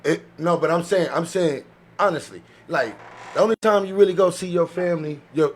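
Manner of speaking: ordinary talk, nothing marked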